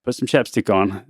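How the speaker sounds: put-on low, soft and calm, like settling a hurt kid; soothing dad voice. Dad soothing injured daughter at basketball game, low and calm. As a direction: gently talk your crying daughter down, unhurried.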